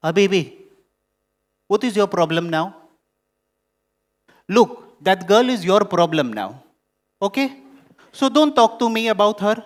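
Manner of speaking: loud, sharp scolding mother; irritated mother telling son to handle his wife; harsh. Retold or imitated. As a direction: cut him off harshly. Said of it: "I heard something on a harsh tone".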